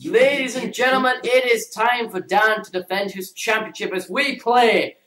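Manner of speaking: big announcer voice building to a loud, drawn-out title; quiz show announcer. Acted. Host to the audience as the championship opens, booming announcer. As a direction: announce it like a title fight, ending in a shout.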